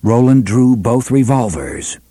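usual speaking voice

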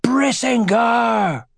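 long, high-pitched shouted invocation; slow, soaring war cry; battle shout. A narrator's reading. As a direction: draw the word out in a ringing shout. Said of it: "and shouted".

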